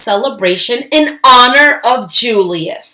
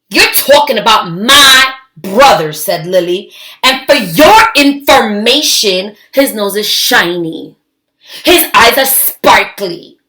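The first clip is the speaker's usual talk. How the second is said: louder, firm protective retort; indignant defense; 5 dB louder than usual. Acted. Lily telling off Cousin Garland, indignant and loud. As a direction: stand up for your little brother.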